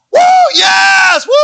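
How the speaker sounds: high, loud victory whoop; excited boy screaming. Retold or imitated. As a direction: scream it like a thrilled young boy. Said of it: "all of a sudden he starts screaming".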